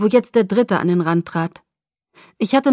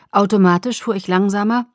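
plain reading voice

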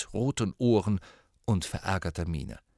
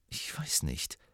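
leise, unsicher; 6 semitones lower, 10 dB quieter, slower than usual. A narrator's reading. Hermine unsicher.

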